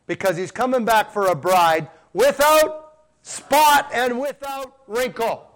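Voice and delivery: slow, high, ringing preacher's cry; rising preaching. Real, not acted. Preacher lifting the church about a spotless bride, slow and soaring. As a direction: stretch each word out to the congregation.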